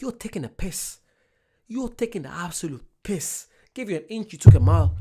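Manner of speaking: retold outrage at the homeless man; mock telling him off; mock indignant. Retelling telling the man off over the drink. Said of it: "I'm like".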